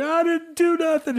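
high, slow whimpering plea; imitating the tough guy blubbering; mock crying. Retelling the arrested guy, mock sobbing innocence. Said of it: "he's crying".

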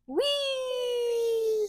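high, delighted squeal of whee; girl thrilled as plane soars; gleeful whee. Acted. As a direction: ride the joy of flying. Character cheers on flight.

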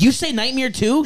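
high, surprised question. Retold or imitated. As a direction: ask it in disbelief. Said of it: "I was like".

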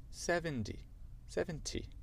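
high, soft British seventy; tentative British seventy, high and soft; British accent. Shown as a demonstration. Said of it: "dire avec l'accent, l'accent britannique".

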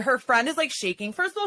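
ordinary talk, nothing marked

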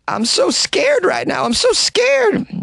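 high, slow, frightened quaver; scared. Retold or imitated. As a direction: voice her panicked fear, drawn out. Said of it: "the woman can be heard saying".

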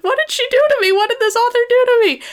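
high, loud incredulous squeal at the book; shrieking in mock outrage at the author; stunned disbelief. Podcast host retelling finishing the book, squealing in loud disbelief. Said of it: "I'm like".

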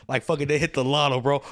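ordinary talk, nothing marked

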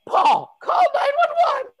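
high yelled call for help; panicked shout. Acted. Bartender yells to Paul for help, panicked shout. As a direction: shout across the room for help.

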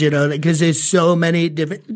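usual speaking voice

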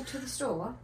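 soft, unsure British accent attempt. Shown as a demonstration. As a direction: mimic a British accent quietly. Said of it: "so I can, like, mimic it".

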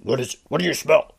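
a hint of a Two Towers orc; lightly put-on orc asking what he smells. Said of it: "you really went for the Two Towers scene".

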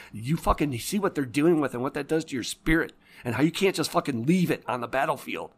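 real fury, voice up high; angry rant. Real, not acted. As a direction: hammer home the disgust. Angry rant about violence.

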